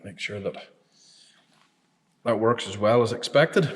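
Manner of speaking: ordinary talk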